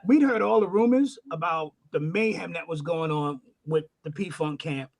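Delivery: ordinary delivery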